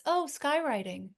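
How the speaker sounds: imitating wide-eyed, high-pitched wonder; childlike wonder. Quoting a favorite line from the movie, childlike wonder. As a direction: quote it with childlike awe, pitch lifted. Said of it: "delivers that with such childlike wonder".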